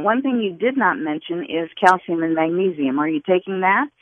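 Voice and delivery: ordinary delivery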